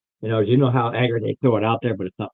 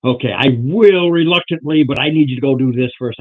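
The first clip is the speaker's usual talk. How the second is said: loud reluctant agreement; 3 semitones higher, 9 dB louder than usual. Acted.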